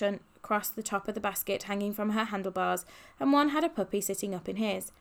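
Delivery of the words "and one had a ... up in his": ordinary delivery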